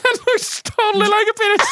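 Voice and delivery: high squealing words through laughter; helpless laughter. Real, not acted. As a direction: burst out laughing as you speak. Bursting out laughing at a drawing.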